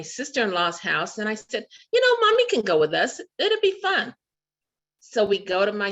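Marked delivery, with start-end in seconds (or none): 1.9-4.1 much higher, quick and bubbly; cheery invite. Retold or imitated. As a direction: talk her into coming as a treat. Cheerfully inviting Mommy along.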